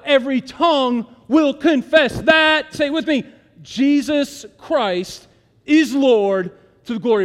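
loud, rising preacher's climax; preaching at full force. Acted. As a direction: build it up to a ringing proclamation.

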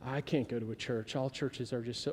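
soft, low, sniffy complaint; a light imitation of a churchgoer who won't go to church; mock home-church snob. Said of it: "those are the people that do home church".

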